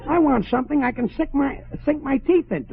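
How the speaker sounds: high-pitched, hungry complaint; exasperated protest. Acted. Starving husband rejects lemon juice.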